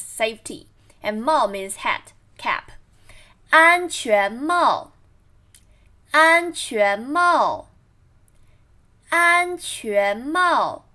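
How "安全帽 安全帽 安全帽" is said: slow deliberate model reading; practicing tones. Shown as a demonstration. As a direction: read 安全帽 slowly, syllable by syllable. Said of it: "read after me".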